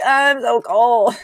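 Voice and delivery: exaggerated shivery self-imitation; mock whining. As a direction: moan it pitifully, drawing it out. Imitates own later complaint of cold.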